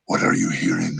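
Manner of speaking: low, breathy demon whisper full of rasp; whispery Kurama voice. Shown as a demonstration. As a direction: hush it into a low, scraping fox-demon whisper. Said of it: "I'm hearing raspy".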